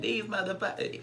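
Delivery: lightly mimicked diva, pitched high; Dre Simber. Retelling a castmate's live, lightly imitated high voice. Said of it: "I'm gonna talk in his voice".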